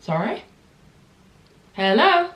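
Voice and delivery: high, loud hello of disbelief; stunned and offended, calling it out; outraged disbelief. Real, not acted. Outraged at salesman's remark.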